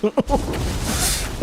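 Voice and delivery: high breathy haughty laugh; snooty titter. Acted. Aristocrat laughing at the peasant, airy titter. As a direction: laugh like a smug noble. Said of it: "aristocrat voice".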